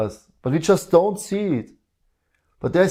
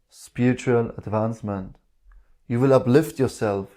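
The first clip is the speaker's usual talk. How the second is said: ordinary talk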